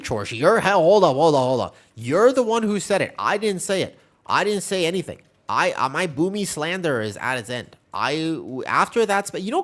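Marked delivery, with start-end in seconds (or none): 0.4-5.2 excited protest. Real, not acted. Protesting teasing accusation on stream.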